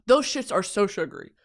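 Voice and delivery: normal talking voice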